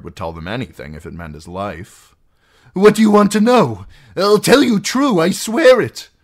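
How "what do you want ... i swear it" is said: high, loud, frantic promise; desperate eagerness. Acted. Captive offering to answer his captor, desperate eagerness. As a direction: swear it as if your life depends on it.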